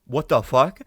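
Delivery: loud, startled outburst; a shocked, loud "what the fuck"; shocked yell. Retold or imitated. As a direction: blurt it out loud in disbelief. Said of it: "And you're like".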